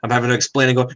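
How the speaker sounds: ordinary delivery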